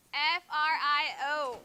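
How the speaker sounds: each letter named separately; spelled out. Shown as a demonstration. Teacher spelling frío for the class, letter by letter. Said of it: "how do you spell frío".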